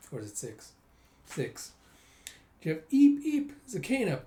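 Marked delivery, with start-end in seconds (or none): 2.9-4.3 very high, squeaky bird chirps; chirping bird. Acted. Little bird chirping at Kana, squeaky and high.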